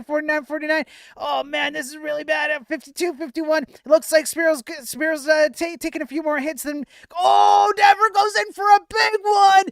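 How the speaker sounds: high, loud play-by-play rising to a shout; excited commentator. Acted. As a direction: yell the big hit like a ringside announcer. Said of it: "get my commentator voice on".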